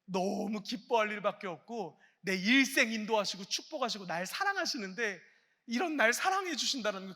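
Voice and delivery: ordinary talk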